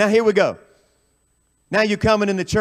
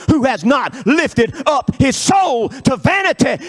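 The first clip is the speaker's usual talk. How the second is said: impassioned revival preacher at full cry; full-force preaching; 11 semitones higher, 6 dB louder, slower than usual. Real, not acted. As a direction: build from firm scripture reciting to high shouted preaching.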